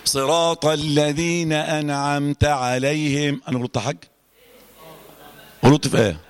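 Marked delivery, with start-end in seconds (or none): 0.1-3.4 slow recitation of صراط الذين انعمت عليهم; careful recitation. Shown as a demonstration. Teacher recites the verse slowly for the class. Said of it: "اقولها".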